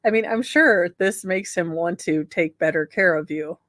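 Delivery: normal talking voice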